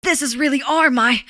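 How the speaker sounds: Deku. Acted. Streamer mocking cursed Deku clips, eager boy voice. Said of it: "would sound like those cursed Deku Insta".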